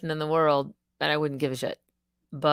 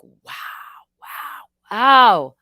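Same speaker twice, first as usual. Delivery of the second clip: amazed wow; 5 semitones higher, 9 dB louder, much slower than usual. Retold or imitated. Reacting to Putin interview.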